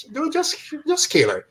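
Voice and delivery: high, pleading exasperation; mock plea to the talking villain; exasperated. Real, not acted. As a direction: beg the villain to get on with it. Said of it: "exasperated at long-winded villain".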